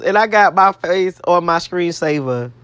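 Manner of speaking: ordinary talk, nothing marked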